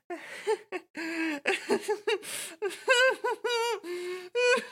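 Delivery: unhinged high laugh; imitated shrieking laugh. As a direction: cackle like she's losing it. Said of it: "she's going".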